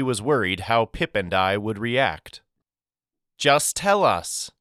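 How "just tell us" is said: slow, higher pleading plea; begging. Acted. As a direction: beg him to just say it. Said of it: "I begged".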